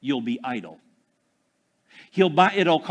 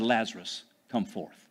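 low and soft, almost under the breath; Jesus quietly calling Lazarus out; quiet command; 7 semitones lower, 7 dB quieter than usual. Acted. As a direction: say it with calm hushed authority. Same command retold quietly.

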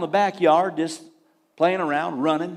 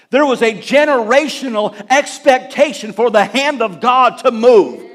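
usual speaking voice

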